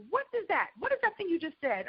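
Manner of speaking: high, quick puzzled question; amused disbelief at a new word; puzzled producers. Retold or imitated. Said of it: "they were like".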